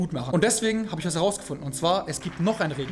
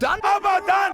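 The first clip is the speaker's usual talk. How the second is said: excited shout; 13 semitones higher, slower than usual. Real, not acted. Sudden shout.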